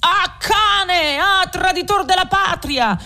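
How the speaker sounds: high, frenzied cry of a man gone wild; furious shout. A narrator's reading. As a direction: spit out the insult like one possessed. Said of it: "gridò ... con un viso da indemoniato". (urlo furioso e acutissimo, da indemoniato)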